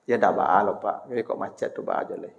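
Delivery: very soft, half-whispered plea; soft small voice. Shown as a demonstration. Wife stays soft with husband stuck in traffic, hushed. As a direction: keep it hushed and humble. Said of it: "tetap begitu suaranya".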